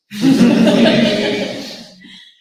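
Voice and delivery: carcajada soplada, muy fuerte y aguda. Real, not acted. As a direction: suelta una carcajada sin voz. Se ríe tras la broma. (ríe a carcajadas tras su broma, carcajada fuerte)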